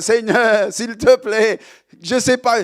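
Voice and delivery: calling out to the Lord in distress; mock cry to God. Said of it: "j'ai crié au Seigneur".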